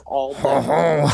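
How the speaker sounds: all vowels said slowly in a joking French accent, the word vowels drawn out; mock French accent. Shown as a demonstration. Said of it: "That's how you do a French accent".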